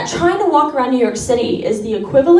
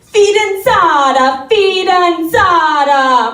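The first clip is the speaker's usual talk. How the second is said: higher, slow, sing-song Italian word; Italian grandma; 5 semitones higher, 3 dB louder, much slower than usual. Retold or imitated. Grandma calling her granddaughter fidanzata, slow Italian lilt. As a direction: draw out each syllable like a nonna. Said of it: "She'd be like".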